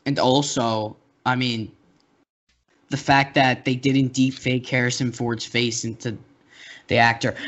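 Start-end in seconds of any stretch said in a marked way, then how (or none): none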